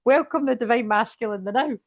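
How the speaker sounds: light Scottish lilt on "the now"; Scottish phrasing. Shown as a demonstration. Reader laughing at card wording, light Scottish lilt. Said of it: "that sounds very Scottish".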